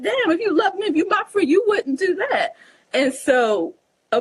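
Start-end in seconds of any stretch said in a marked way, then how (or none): 0.0-2.6 hurt reproach. Retold or imitated. Imagined reproach to a betraying friend.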